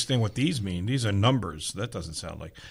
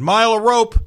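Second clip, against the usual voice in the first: loud diner order call; yelled order; 9 semitones higher, 6 dB louder than usual. Retold or imitated. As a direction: call it back to the kitchen. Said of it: "would yell back to the kitchen".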